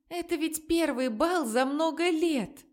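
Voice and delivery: tearful shout, pitched high; angry crying. Acted. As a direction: cry it out through tears. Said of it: "Сквозь слёзы кричала одна из девушек".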